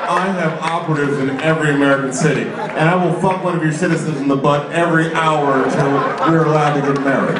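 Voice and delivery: solemn villain's broadcast. Acted. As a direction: threaten the nation from a hideout tape.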